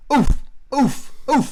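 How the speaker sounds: loud, high mocking oof of effort; mock straining grunt. Teasing friend about the fence, loud high mock grunts. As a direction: grunt like a big guy hauling himself over a fence. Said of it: "that was you trying to climb that fence".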